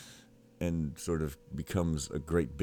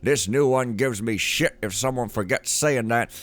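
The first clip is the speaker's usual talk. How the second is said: higher, forceful character read; gruff-talking note writer; 6 semitones higher, 6 dB louder than usual. Acted. Player reading a game note aloud, forceful character voice. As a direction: read it out as a bossy underling, higher.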